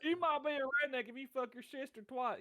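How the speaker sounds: mocking comedian bit. Imitating Jeff Foxworthy joke.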